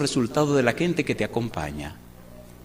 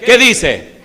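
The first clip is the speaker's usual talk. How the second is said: higher and much louder, thundering from the pulpit; fiery preaching; 10 semitones higher, 12 dB louder, much slower than usual. Acted. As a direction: blast it out at the congregation, half mocking. (predicación a todo pulmón)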